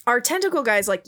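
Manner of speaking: ordinary delivery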